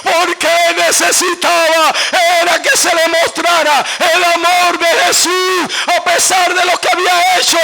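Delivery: loud, high, shouted sermon; full-force preaching. Real, not acted. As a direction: shout it to the whole congregation in driving phrases.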